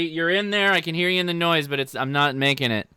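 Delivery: ordinary talk, nothing marked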